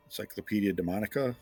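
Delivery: usual speaking voice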